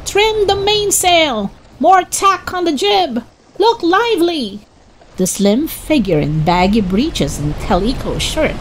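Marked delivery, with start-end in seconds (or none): 0.0-4.6 shouted orders. A narrator's reading. Sailor takes charge in the storm, shouting orders high. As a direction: shout it up into the gale. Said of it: "The sailor began to shout orders".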